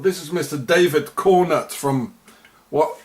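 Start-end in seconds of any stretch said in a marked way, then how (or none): none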